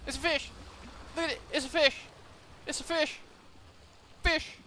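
high-pitched excited repeats; high, eager, insistent; excited announcing. Real, not acted. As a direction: show off the catch in a high voice. Showing off the catch.

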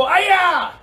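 high, loud kiai; karate shout. Shown as a demonstration. Teacher leading kids in the karate yell. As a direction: yell it with full force. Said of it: "ready, stance, go".

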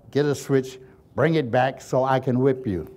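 ordinary talk, nothing marked

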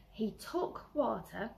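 ordinary delivery

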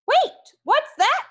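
very high, slow, drawn-out squeal of discovery; excited squeal. Acted. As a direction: squeal it like a thrilled little pig. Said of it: "Norbert squealed".

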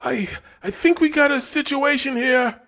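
high, loud stammering panic; sputtering. A narrator's reading. Brent sputtering to Dina in fear. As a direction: stumble over the words in fear.

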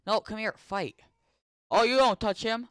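high, loud protective yelling; real high angry yells; angry shouting. Real, not acted. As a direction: shout to defend him. Defends his pet in a fight.

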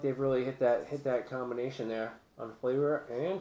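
chewing while talking; mouth full. Real, not acted. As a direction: hand covering mouth. Seen 1: hand holds patty piece at mouth while talking.

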